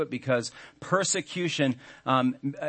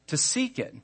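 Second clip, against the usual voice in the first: seek lifted high and leaned on; stress on seek; 10 semitones higher than usual. Shown as a demonstration. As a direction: hit the word seek. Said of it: "I'd like to emphasize every word".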